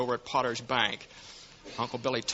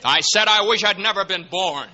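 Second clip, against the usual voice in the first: loud, higher, bitter outburst; despairing shout; 6 semitones higher, 7 dB louder than usual. Acted. George shouting his wish at the angel.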